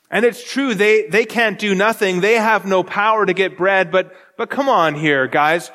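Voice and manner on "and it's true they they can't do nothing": normal talking voice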